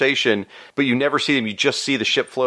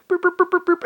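high squeaky little boop noises, much higher; imitated boops. Describing a weak hero's feeble power, squeaky mock boops. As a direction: make a tiny toy-like zap sound.

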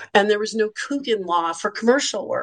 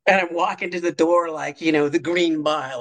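ordinary delivery

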